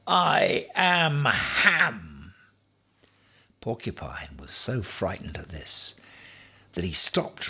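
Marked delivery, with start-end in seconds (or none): 0.1-2.3 very slow, high solemn declaration; grand proclamation. A narrator's reading. Ham ends his threat to porcupine: slow grand. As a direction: pronounce it grandly.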